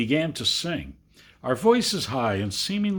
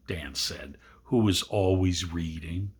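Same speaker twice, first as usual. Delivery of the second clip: ordinary delivery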